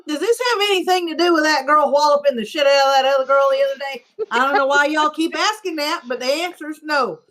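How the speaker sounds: lightly voiced Southern coach at a press conference; Southern accent. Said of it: "I'm not saying that was a good accent".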